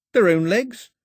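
high, loud rising query; incredulous question. A narrator's reading. Mao presses Pilou about whose legs, incredulous.

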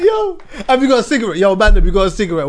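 a hint of a bossy demand, laughing; lightly voiced pushy cigarette ask. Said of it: "he's got up bossy".

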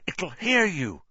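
high-pitched urgent warning; frantic. A narrator's reading. Harry afraid the thing will hear Ron, frantic. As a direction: warn in a panic, pitched high. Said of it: "said Harry frantically".

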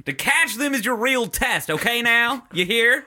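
high mock-country pep talk; Little League coach. Coach telling players the real test, high country coach voice. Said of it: "like a Little League coach".

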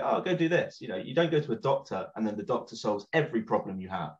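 ordinary talk

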